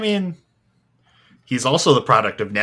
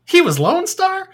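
shocked; 8 dB louder than usual. Retold or imitated. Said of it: "I was like".

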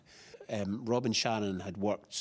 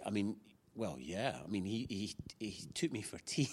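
ordinary delivery

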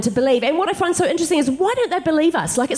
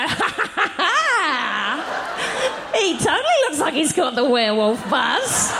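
laughing; 2 semitones higher, much slower than usual. Retold or imitated. Nudging her husband, laughing as she speaks. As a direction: giggle it out, half laughing. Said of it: "I go".

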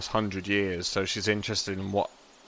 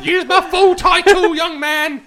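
put-on cheeky old gnome voice, pitched way up and louder; old gnome Ola mocking the young man, loud and high; 13 dB louder than usual. Ola demands his title.

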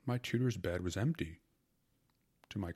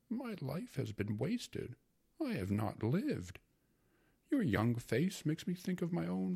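put-on a sentimental uncle confiding in a young wife; wistful uncle; 10 semitones higher than usual. A narrator's reading. Uncle flattering Tatiana, higher and wistful. Uncle laments lost youth.

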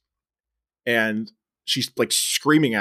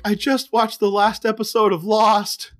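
bawling; 9 semitones higher, slower than usual. Retold or imitated. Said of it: "sitting there just bawling".

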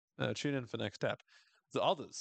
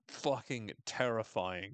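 ordinary talk

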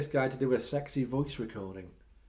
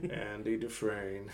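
Morgan Freeman impression; 5 semitones lower, 7 dB quieter, slower than usual. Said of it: "Morgan Freeman".

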